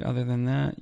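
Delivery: normal talking voice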